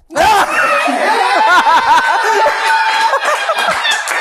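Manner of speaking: loud high clown laugh; big triumphant clown cackle. Acted. Magic reveal.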